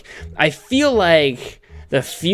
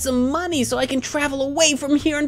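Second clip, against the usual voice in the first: character voice; 10 semitones higher than usual. Acted. Voicing a character's wish.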